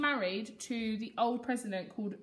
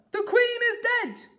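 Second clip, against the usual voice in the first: high, loud guards' cry; shouted; 12 dB louder than usual. Acted. Guards bursting into the cell shout to Elizabeth, loud. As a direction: shout out the big news, much higher and louder. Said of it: "they shouted".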